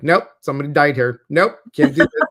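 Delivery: fast, loud string of nopes; brisk rejections. Retold or imitated. Host reliving her apartment hunt, loud and fast. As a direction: reject each apartment quickly and loudly. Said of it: "I'm like".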